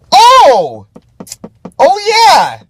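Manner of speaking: loud, high burst of sudden recall; excited realization. Real, not acted. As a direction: cry out as it comes back to you. Sudden recollection of another point.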